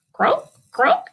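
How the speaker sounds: light mock frog croak. Frog sound in picture book.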